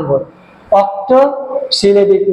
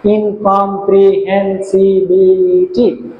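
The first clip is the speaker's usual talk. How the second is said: ইনকম্প্রিহেনসিবিলিটি slowly, each syllable marked off; slower than usual. Shown as a demonstration. Teacher confirming eight syllables in ইনকম্প্রিহেনসিবিলিটি, syllable by syllable. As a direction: stretch ইনকম্প্রিহেনসিবিলিটি into its separate syllables. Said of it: "আটটা syllable".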